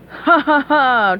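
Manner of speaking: slow gloating villain laugh; slow evil chuckle. Acted. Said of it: "chuckled Plankton".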